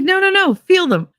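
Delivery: insistent. Retold or imitated. Friend insists, high pitched.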